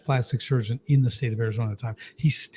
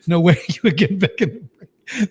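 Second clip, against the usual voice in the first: laughing doctor quote; 5 semitones higher than usual. Retold or imitated. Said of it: "he's like".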